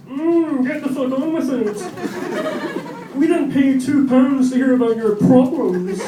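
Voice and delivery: high, loud, mocking heckler voice; audience voice. Comic voicing the silent audience's fed-up thoughts, high and mocking. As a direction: sneer it as a fed-up crowd. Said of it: "Your stony silence clearly says".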